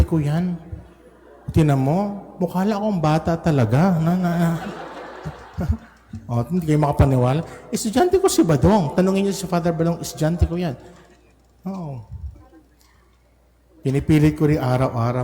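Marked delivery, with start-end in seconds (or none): 4.5-5.3 a quick airy chuckle; soft breathy laugh. Real, not acted. Priest chuckling at his own joke to the congregation, breathy laugh. 7.7-10.8 higher, playful insisting; playful insistence. Real, not acted. Priest insisting to doubting parishioners, playful higher pitch.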